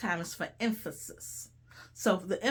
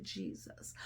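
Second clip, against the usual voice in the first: whispered name; 2 semitones lower, 16 dB quieter than usual. Real, not acted. Preacher warning the congregation against murmuring, whispered.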